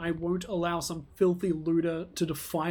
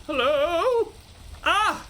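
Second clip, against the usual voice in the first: high put-on NPC voice; character voice; 9 semitones higher, much slower than usual. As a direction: greet them in a character voice. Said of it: "I'm giving him the wrong voice".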